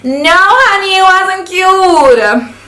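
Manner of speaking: high, drawn-out sassy put-down; no honey, with attitude. Real, not acted. Sassy dismissal of old teeth.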